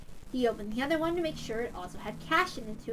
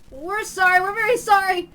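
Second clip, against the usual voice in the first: higher, louder frantic apology; pleading apology; 8 semitones higher, 12 dB louder than usual. Acted. Newcomers grovelling to the man, high frantic apology. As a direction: grovel out the sorry. Newcomers bowing, begging forgiveness.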